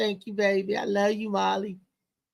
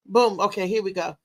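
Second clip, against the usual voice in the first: normal talking voice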